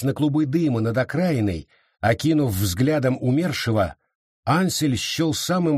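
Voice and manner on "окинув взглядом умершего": usual speaking voice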